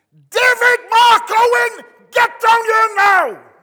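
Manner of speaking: high, full-force yell up the stairs; long, loud, high-pitched summons; mother's shout. Retold or imitated. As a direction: bellow like an angry mum calling her child. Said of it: "my mother used to stand at the bottom of the stairs and shout up".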